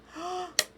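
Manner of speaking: sudden startled gasp; sharp gasp. Real, not acted. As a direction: catch your breath in alarm. Sudden flooring mishap noticed.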